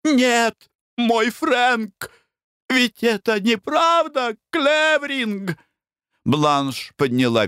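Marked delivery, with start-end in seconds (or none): 0.0-5.6 high, frantic pleading; hysterical pleading. A narrator's reading. Mother frantically pleads with son Frank, high. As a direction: beg desperately. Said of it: "mother pleads hysterically".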